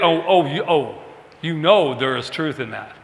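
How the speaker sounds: ordinary talk, nothing marked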